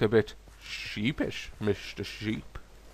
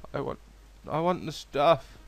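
ordinary talk, nothing marked

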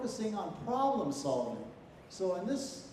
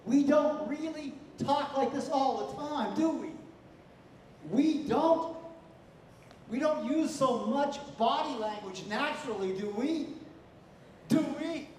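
higher, louder, overacted emoting; exaggerated delivery; 4 semitones higher, 4 dB louder than usual. Shown as a demonstration. Teacher mocking students' overdone drama style. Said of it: "you're over-exaggerating your body language".

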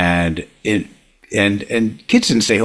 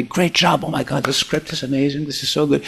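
lightly voiced, higher gushing praise; gushing nice Carl; 5 semitones higher than usual. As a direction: play the sweet boss heaping on compliments. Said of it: "He's so nice".

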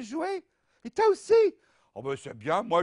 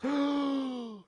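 mock amazed gasp; 7 semitones higher than usual.

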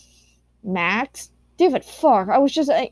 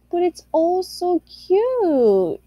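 higher, slow gushing coo; cutesy coo; 7 semitones higher, slower than usual. Acted. Gushing to viewers about cute art, high slow coo. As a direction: coo it like talking to a baby. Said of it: "Ladle, why are you talking like this?".

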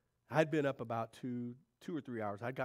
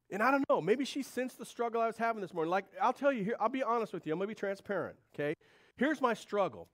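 higher, louder earnest confession; passionate preaching; 10 semitones higher, 8 dB louder than usual. Real, not acted. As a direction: pour out the struggle to the church.

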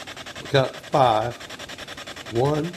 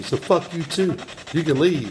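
pitched up, indignant send-off; angry retort; 6 semitones higher than usual. Real, not acted. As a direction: throw it back and show the door. Cursing back, ordering spirit out.

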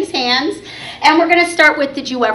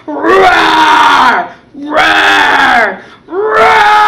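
loud playful dinosaur roar; hammed-up monster roar; 5 dB louder, much slower than usual. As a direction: roar like a big friendly dinosaur. Dinosaur puppet roaring.

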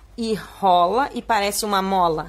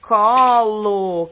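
"Colo" loud and stretched; slow, projected syllables; drawn-out reading; 8 dB louder than usual. Shown as a demonstration. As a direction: sound it out long and loud for the class. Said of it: "como que a gente escreve essa palavra".

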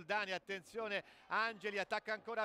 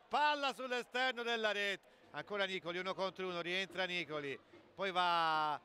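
ordinary delivery

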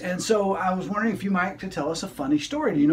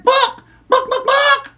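higher clucky chicken bocks; chicken clucking book; 9 dB louder, slower than usual. Acted. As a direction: cluck the words like a hen. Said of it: "chicken ... said".

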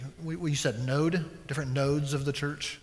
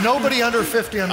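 quick, loud laughing quip; 7 semitones higher, 10 dB louder, much faster than usual. Real, not acted. Cohost cracks a joke about the old reference, laughing loud and fast. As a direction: blurt it out fast through a laugh.

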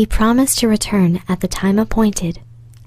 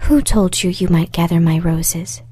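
ordinary delivery

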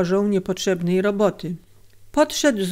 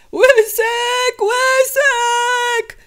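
very high, long-held calling; drawn-out high wail of a trapped man; desperate cry; 3 dB louder, much slower than usual. A narrator's reading. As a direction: scream the name out for help, stretching it. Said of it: "krzyknął jeszcze raz".

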